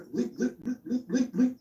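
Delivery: high, squeaky cartoon pig attempt; hammed-up pig squeals breaking into laughter; Porky Pig. Streamer attempting a cartoon pig for chat, hammed-up high voice. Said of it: "I can't do Porky Pig".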